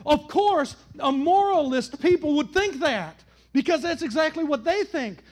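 loud, high, rising preacher's cadence; impassioned pulpit preaching; full-force preaching. Acted. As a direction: build the sermon to a shout.

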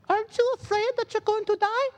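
very high falsetto princess with a mock French accent. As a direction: put on a high, French-ish queen-to-be voice. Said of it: "She had, like, a French accent".

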